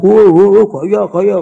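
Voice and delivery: loud hooting heckle from the back; mock the show-off with drawn-out hoots; jeering classmates. Classmates at the back jeer the student who moved forward, loud mock heckling. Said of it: "Teman-teman saya di belakang".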